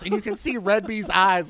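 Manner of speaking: usual speaking voice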